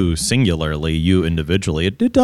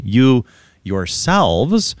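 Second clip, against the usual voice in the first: "you yourselves" slow, with a rising "yourselves"; stressed you yourselves; 3 semitones higher, much slower than usual. Shown as a demonstration. Host showing the emphatic pronoun, slow stressed "you yourselves". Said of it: "that emphasis".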